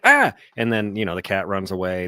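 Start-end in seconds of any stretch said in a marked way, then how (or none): 0.0-0.3 mock scream. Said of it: "The dude's like".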